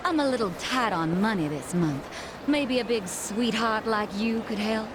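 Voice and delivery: put-on Kansai accent. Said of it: "Say something else".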